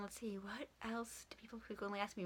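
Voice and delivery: half-whispered aside; whispering. Real, not acted. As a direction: whisper it to yourself. Quiet aside while thinking.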